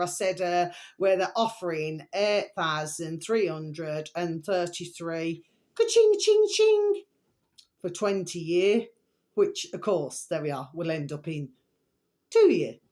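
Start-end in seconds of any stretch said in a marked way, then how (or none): 5.7-7.0 high cash register ka-ching. Pretend. Cash register sound effect.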